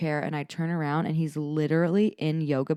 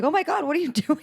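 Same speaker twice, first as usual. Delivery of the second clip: higher and louder laughing exclaim; amused disbelief; 11 semitones higher, 5 dB louder, faster than usual. Retold or imitated. Mom reacting to her son doing yoga in the sprinklers, amused. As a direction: blurt it, cracking up at the sight. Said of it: "I was like".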